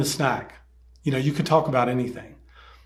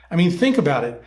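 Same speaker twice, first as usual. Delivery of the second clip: normal talking voice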